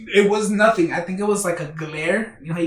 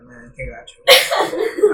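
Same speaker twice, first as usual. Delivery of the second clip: slow reassuring; 5 semitones lower, 6 dB louder, much slower than usual. Retold or imitated. Drunk comforting knocked-out friend.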